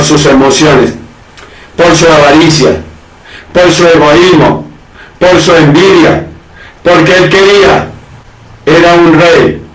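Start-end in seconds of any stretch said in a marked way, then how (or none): none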